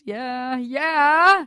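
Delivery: "yeah" building to a loud, high whoop; excited cheer. Real, not acted. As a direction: celebrate with a rising, drawn-out cheer. Cheering herself as principal for a day.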